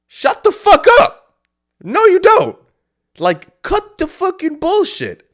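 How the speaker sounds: full-volume, high-pitched yell, words stretched out; furious shout. Acted. Speaker talks back to the voice in their head, furious shouting. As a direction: yell it down with everything you've got.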